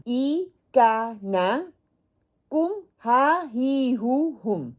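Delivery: ای کا نا، کم ہا ہی ہو ہم drawn out syllable by syllable; slow recitation again. Shown as a demonstration. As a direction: chant the six endings very slowly. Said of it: "یہ چھ حرف ہیں جو آپ نے لرن کرنے ہیں".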